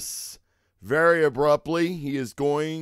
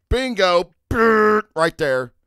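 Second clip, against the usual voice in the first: loud, higher, triumphant; excited find; 6 semitones higher, 8 dB louder, much slower than usual. Real, not acted. Host finds his social links, excited. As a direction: nail the moment of finding it.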